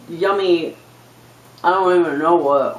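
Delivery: ordinary delivery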